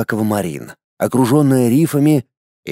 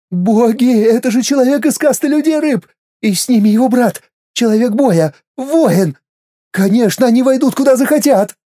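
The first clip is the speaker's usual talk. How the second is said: terrified wailing; 10 semitones higher than usual. Acted. Inner voice in terror.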